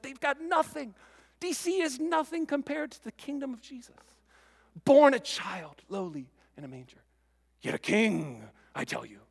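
lightly voiced old British preacher, rising high; a hint of a grand Welsh preacher proclaiming; mild mock pulpit thunder; Lloyd Jones impression. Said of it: "my best Lloyd Jones impression".

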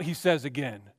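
usual speaking voice

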